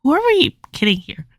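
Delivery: high, disbelieving who-are-we-kidding; a high mock disbelief at an obvious lie; incredulous. Mocking the caller's fake name, high and incredulous.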